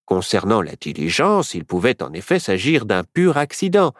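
usual speaking voice

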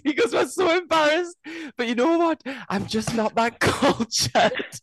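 high, giggly confession; laughing. Real, not acted. Laughing at herself about her uncultured holidays. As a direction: laugh through the admission.

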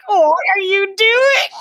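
high, slow, laughing disbelief; mock shocked boyfriend; baffled laughing question. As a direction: ask through laughter, incredulous.

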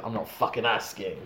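low, quiet action-hero menace. Retold or imitated. Batista character refusing to take no from a friend, voice low. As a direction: drop it low and soft as a mock tough guy. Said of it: "Batista's voice has gone so low".

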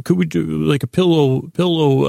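normal talking voice

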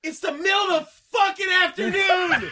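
high, loud, incredulous shouting; exasperated yell. Retold or imitated. Podcast host ranting at the movie character, loud and high. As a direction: yell in disbelief at the screen.

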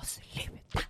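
tonlös viskning; väsa åt systern i smyg; viskat stopp. Retold or imitated. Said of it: "Jag bara". (viskande till systern på bussen)

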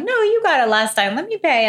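high, bright mock insisting; playful insistence. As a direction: insist you'll pay, playfully. Playful fight over the bill.

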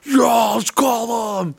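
put-on high, drawn-out drunken holler; imitating drunk friends. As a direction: whoop like a hammed-up drunk pal. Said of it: "even when friends get drunk and shit".

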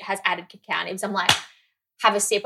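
ordinary talk

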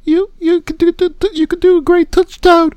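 very high squeaky woman's voice; squeaky female voice. Pretend. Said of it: "you're going too squeaky".

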